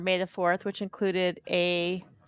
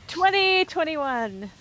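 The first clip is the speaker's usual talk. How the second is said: excited cheer; 9 semitones higher, much slower than usual. Real, not acted. New Year cheer.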